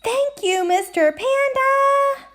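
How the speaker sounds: high, drawn-out happy critter voice; high excited lemur. Acted. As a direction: gush with delight at the gift. Voicing Lemur receiving gift.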